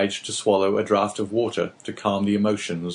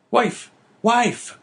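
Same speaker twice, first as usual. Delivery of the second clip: much higher, eager call to his wife; crying out; 4 dB louder than usual. Acted. Innkeeper dazzled by the diamond, calling to his wife. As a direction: call her over in excitement. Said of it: "cried he".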